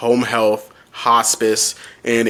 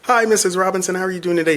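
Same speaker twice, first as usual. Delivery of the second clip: bright, much higher customer-service voice; cheery phone greeting; 8 semitones higher, faster than usual. Retold or imitated. Zach retelling answering the client's call, high cheery greeting. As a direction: lift the pitch for a friendly phone hello.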